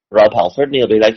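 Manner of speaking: normal talking voice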